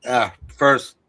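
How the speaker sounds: talking through a yawn. Real, not acted. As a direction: say it mid-yawn. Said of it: "please excuse my yawning".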